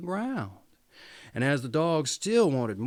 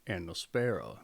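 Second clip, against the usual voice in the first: ordinary delivery, nothing marked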